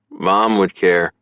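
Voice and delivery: slow, mildly stubborn retort; understated stubbornness. A narrator's reading. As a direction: dig in a little, slowly. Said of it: "he said stubbornly".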